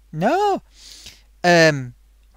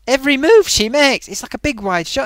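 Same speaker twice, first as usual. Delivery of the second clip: usual speaking voice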